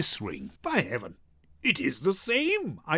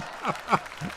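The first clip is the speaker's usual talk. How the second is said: laugh; 5 semitones higher, 3 dB quieter than usual. Acted. Graziano laughs at his bawdy joke.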